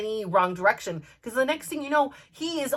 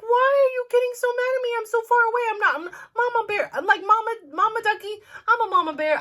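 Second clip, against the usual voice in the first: high, loud-edged defensive plea; pleading protest; 12 semitones higher, 3 dB louder, faster than usual. Retold or imitated. Telling squawking mama duck she means well, high pleading protest. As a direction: protest your innocence in a high voice.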